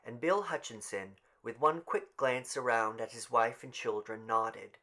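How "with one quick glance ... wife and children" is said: ordinary delivery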